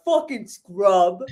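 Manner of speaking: drawn-out, slow mock curse; imitating a friend's catchphrase; Steve impression. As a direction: slowly stretch it out as a teasing impression. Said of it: "Steve voice in our heads going".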